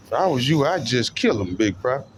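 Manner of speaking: ordinary delivery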